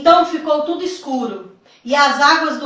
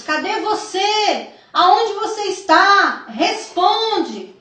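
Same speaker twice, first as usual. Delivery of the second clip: put-on high, drawn-out calling for lost children; imitated mothers' cries; 6 semitones higher, slower than usual. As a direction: act out a mother calling out desperately. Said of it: "Mães gritavam pelos seus filhos".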